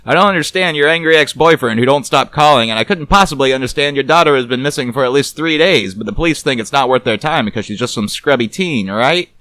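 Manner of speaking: loud, fast wisecracking private eye; gruff detective voice. A narrator's reading. Narrator voices Garbo teasing the nervous woman, wry.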